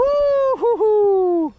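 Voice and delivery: high, slow, loud woo-hoo-hoo; drawn-out whoop. Real, not acted. Angler crowing over a nice fish, slow high whoop. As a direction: stretch the whoop out long and high. Celebrating a catch.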